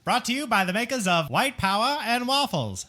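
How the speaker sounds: mock announcer. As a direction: boom out a fake sponsor line.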